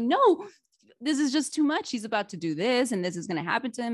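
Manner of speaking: dramatic protest. Retold or imitated. Protesting to husband about show.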